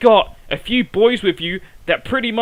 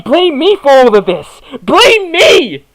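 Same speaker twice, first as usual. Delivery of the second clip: "Blame me!" shouted high and loud; furious shout; 4 semitones higher, 7 dB louder, slower than usual. Acted. Wrestler yelling at the crowd, furious shout. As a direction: explode with outrage. Angry promo outburst.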